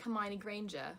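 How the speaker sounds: normal talking voice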